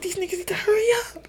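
very high, impatient whine; mock-irritated squeal. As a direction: imitate a fed-up friend griping. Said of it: "he was so irritated".

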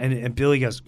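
usual speaking voice

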